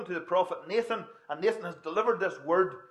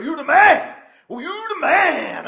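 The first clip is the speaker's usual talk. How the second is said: much higher, much louder cheer, slowing at the end; exuberant shout; 11 semitones higher, 14 dB louder, slower than usual. Retold or imitated. Preacher acting out a cheering movie crowd, exuberant shout. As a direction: bellow it like an ecstatic movie crowd. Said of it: "it gets louder and louder and more exuberant".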